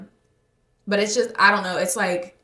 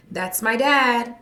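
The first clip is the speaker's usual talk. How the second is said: slow, louder call, a bit higher; proud yell; 5 semitones higher, 8 dB louder, much slower than usual. Retold or imitated. Daughter calling after her dad in public, proud slow shout. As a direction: call it out proudly across a parking lot. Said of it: "I would yell out".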